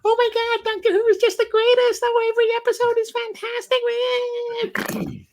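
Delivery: high, gushing fan parody that speeds up, then drops off at the end; mocking gushing fan. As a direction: parody an overexcited superfan. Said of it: "the ones where it's like".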